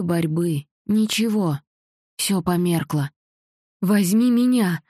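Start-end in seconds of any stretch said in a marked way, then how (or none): 3.8-4.8 higher, louder desperate plea; pleading mother. A narrator's reading. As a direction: beg to be taken instead. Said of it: "взмолилась мама".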